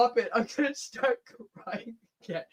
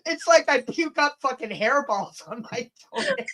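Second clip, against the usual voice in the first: laughing through the words, higher and louder; giggling disbelief; 13 semitones higher, 8 dB louder than usual. Real, not acted. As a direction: crack up while describing it. Laughing at hairy toenails.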